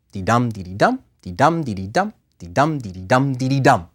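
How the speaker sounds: sing-song limerick beat, dee light, dum hit; limerick rhythm. Shown as a demonstration. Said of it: "How does a limerick meter go?".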